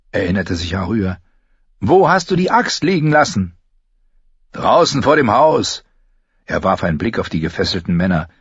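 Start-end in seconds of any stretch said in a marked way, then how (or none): 1.8-5.8 raised, higher voice; urgent exchange. Acted. Arya and Gendry locating the axe, urgent. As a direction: call it over the roar of a fire. Shouted exchange in burning barn.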